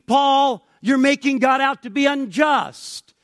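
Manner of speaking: high, loud challenge; loud, incredulous protest; indignant objection. Acted. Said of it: "saying in objection to his teaching".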